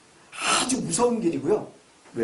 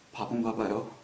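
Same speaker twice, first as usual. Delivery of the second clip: low, soft, resigned reply; meek child's answer; 13 semitones lower, 11 dB quieter than usual. Retold or imitated. Son answering his scolding father, meek and quiet. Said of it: "그랬더니".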